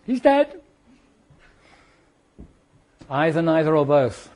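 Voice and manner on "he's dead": high, choked mock-tearful; grieving widow. Widow telling the caller her husband died, high and choked. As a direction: blurt the news through grief. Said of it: "And then the voice says".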